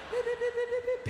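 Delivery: high-pitched imitated phone beeps; high pip beeps. Comic mimicking payphone pips running out, high beeps.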